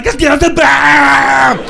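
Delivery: high, drawn-out garbled shout; Arnold Schwarzenegger. Acted. Comic answers why he is yelling, hammed-up Schwarzenegger scream. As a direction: bellow it slowly in a mock Austrian accent. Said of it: "He starts screaming".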